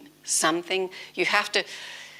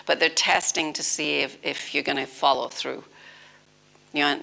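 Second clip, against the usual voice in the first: ordinary delivery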